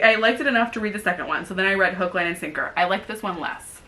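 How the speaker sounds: ordinary talk, nothing marked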